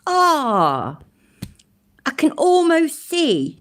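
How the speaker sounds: high, long drawn-out exclamation of disbelief; scandalised, slow and high-pitched; mock outrage. Real, not acted. Mock outrage at clip's claim.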